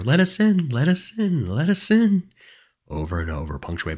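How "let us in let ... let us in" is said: high, loud, frantic chant; high-pitched wailing demand; pleading, louder and louder. A narrator's reading. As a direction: beg insistently at full voice. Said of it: "voice getting louder and louder".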